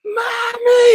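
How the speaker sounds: very high imitated toddler wail; child screaming for mommy. Retelling the toddler's cry for her mother, high imitated wail. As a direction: mimic a little kid shrieking for mom. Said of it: "that kid screamed".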